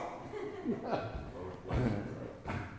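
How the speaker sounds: soft laugh to himself. Real, not acted. Preacher who wasn't gonna preach on this, chuckling. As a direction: laugh quietly, off to the side.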